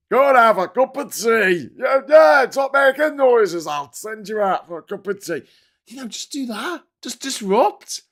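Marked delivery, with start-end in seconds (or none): 0.1-1.7 louder mock Speaker calling over the din; Speaker of Parliament. Speaker to heckling politicians, mock bellow. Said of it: "the speaker has to say". 1.8-5.4 loud mock Speaker scolding the benches; Speaker of Parliament. Speaker ordering noisy politicians to stop, hammed-up shout. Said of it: "the speaker has to say".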